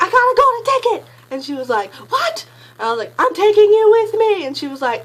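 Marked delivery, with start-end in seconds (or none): 0.0-1.1 much higher, louder squealed news; ecstatic announcement. Retold or imitated. Telling best friend the news. 1.9-2.5 very high squeaked what; shocked disbelief. Retold or imitated. Friend reacting in disbelief. 3.2-4.5 much higher, louder, slow; thrilled shout. Retold or imitated. Telling friend she's coming.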